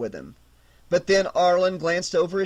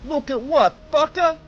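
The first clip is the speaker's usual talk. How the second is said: higher, louder menacing thug; thug threatening; 10 semitones higher, 4 dB louder than usual. A narrator's reading. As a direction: spit the threat in his face.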